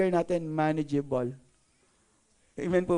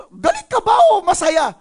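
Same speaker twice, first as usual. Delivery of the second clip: high, loud baffled demand; mock confrontational shout; 11 semitones higher, 10 dB louder than usual. Asking someone whose face never changes, exasperated shout. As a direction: shout the question in comic exasperation.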